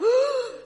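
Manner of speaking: a big, high, loud theatrical gasp; mock shocked gasp. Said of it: "We're like".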